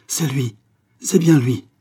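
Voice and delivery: joyful shout of recognition; excited exclamation. A narrator's reading. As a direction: cry it out in triumph. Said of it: "exclama-t-il".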